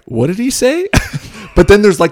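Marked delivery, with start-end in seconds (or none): 0.0-0.9 higher, bewildered question; high-pitched baffled posh guy. Retold or imitated. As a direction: ask it high and baffled, a confused posh Brit. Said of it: "and then, like".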